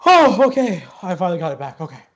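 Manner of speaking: winded ticket seller, high and loud; out of breath. Acted. Flustered half-elf greets the two arrivals, out of breath. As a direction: puff out an apologetic greeting. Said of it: "seems out of breath".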